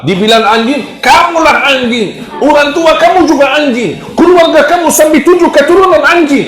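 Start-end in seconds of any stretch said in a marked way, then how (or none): 1.0-6.5 high, rising furious insult that climbs as it goes; angry retort. Acted. An angry person hurls insults back at whoever called them a dog. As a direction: spit the insult back, getting shriller each line. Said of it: "Beda sama orang marah".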